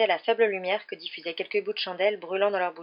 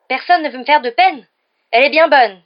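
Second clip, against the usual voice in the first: very loud, high indignant wail; lamenting; 7 semitones higher, 15 dB louder than usual. A narrator's reading. Mimi scoffs at Hermione's assurance, wailing loudly. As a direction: throw it back as a bitter lament. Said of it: "se lamenta Mimi".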